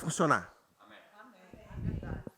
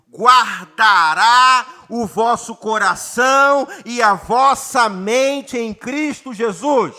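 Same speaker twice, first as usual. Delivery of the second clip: slow, drawn-out preacher's reading of the verse; intoned scripture reading; 5 dB louder, much slower than usual. Acted. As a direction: stretch each phrase like a solemn chant. Pastor reads verse, intoning slowly.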